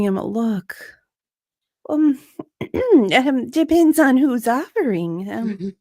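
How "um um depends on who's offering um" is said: high, loud, nervous throat-clearing; stall, then answer coyly; flustered Kate. Acted. Kate answering Liam's raw joke, high flustered.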